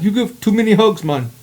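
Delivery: high put-on voice of a foreign friend; imitating the African guy. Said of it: "And he's like".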